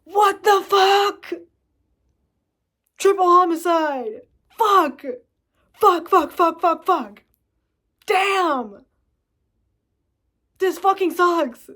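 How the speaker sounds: high, loud, slow hammed-up yelling; furious outburst. Stranded driver cursing at his luck, loud furious shouting. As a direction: explode with cartoonish rage. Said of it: "I'm gonna be like".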